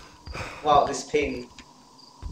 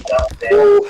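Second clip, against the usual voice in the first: sudden shout; 11 dB louder than usual. Real, not acted. Frustrated shout at friend.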